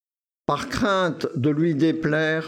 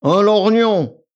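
loud, high and drawn-out announcement; pompous, slow proclamation; self-important; 5 semitones higher, 9 dB louder than usual. A narrator's reading. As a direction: puff yourself up as you name it. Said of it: "répondit-il plein d'importance".

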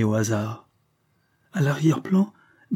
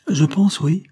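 higher, louder irritated reply; angry; 7 semitones higher, 5 dB louder than usual. A narrator's reading. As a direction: snap it out with raised pitch. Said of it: "dit-il d'un ton colère". (d'un ton colère, voix haussée)

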